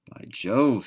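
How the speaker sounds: sudden hunter's alert; startled exclaim. A narrator's reading. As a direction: call out on spotting the kangaroo. Father spots kangaroo.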